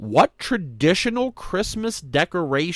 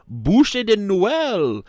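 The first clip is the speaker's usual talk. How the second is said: rough attempt at a French accent; slow, higher, put-on French pronunciation; 6 semitones higher, 4 dB louder, much slower than usual. Host reading a cake's name, imperfect French accent. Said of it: "I wasn't doing a good French accent".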